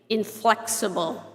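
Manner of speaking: normal talking voice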